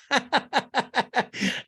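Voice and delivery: high staccato cackle; imitated mean laughing fit; mock laugh. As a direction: cackle in even high bursts. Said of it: "she snorts and continues to laugh".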